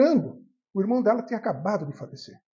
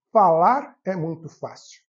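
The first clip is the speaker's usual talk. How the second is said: slow emphatic; 3 semitones lower, 6 dB louder, much slower than usual. Real, not acted. Speaker's talk, a pointed remark.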